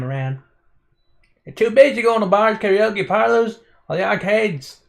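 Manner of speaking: hammed-up rural country accent, high and loud; Billy Bob country accent, big and high. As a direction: play a loud, over-the-top country bumpkin. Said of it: "I'll try out the country accent".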